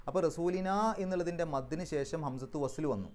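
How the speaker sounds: ordinary talk, nothing marked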